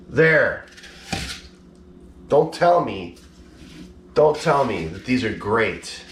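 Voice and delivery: ordinary delivery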